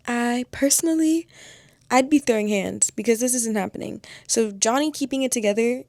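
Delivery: indignant, loud and playful; heated rant. Real, not acted. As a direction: rant hard for your favorite character. Defending Johnny, fired up rant.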